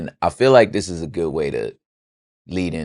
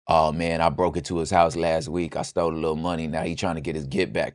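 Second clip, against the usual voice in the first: sheepish confession; 3 semitones lower, 7 dB quieter than usual. Pretend. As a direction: lower and much softer, admitting it. Friend confesses what he did.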